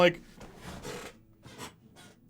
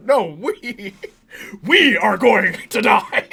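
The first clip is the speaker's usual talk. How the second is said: laughing Eddie; 4 semitones higher, 5 dB louder, slower than usual. Retold or imitated. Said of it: "repeats Eddie's line".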